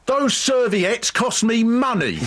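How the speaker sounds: high, slow, hammed-up shout at the customers; retold screaming. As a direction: yell it out like the furious owner. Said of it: "after all this screaming".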